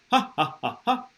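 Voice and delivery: detached ha's bounced from the stomach; staged belly laugh for demonstration; demonstrated laugh pops. Shown as a demonstration. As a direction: pop out an even mock laugh. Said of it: "pop, as if you're laughing".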